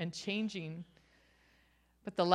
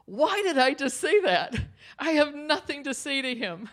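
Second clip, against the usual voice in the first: higher, louder, rattled self-questioning; startled inner thought; 7 semitones higher, 5 dB louder than usual. Retold or imitated. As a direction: blurt out the thought in disbelief at yourself. Inner thought, regret at own words.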